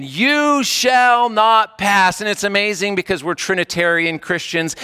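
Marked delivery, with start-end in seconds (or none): 0.0-2.2 slow, higher and louder; louder Gandalf command. Retold or imitated. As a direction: raise it up, forbidding the monster. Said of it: "And he says it again".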